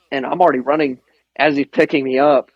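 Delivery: normal talking voice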